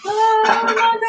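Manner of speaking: high-pitched, sung-out delight; delighted amazement. Real, not acted. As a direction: squeal it high in real delight. Delighted by the next pan.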